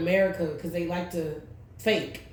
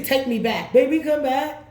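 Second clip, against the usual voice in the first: a mild mock holler, rising higher; mock screaming; 7 semitones higher, 6 dB louder than usual. Retelling the rival begging her to come back, a mild mock holler. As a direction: wail it half-heartedly, pleading. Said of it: "She was screaming. She's hollering".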